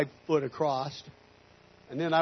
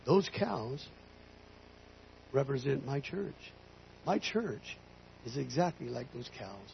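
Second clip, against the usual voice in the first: hushed, deliberate divine voice with long pauses; softly explain, as God would; 3 semitones lower, 10 dB quieter, slower than usual. Retold or imitated. God explaining the cows to the rancher, soft God voice. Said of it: "And he goes".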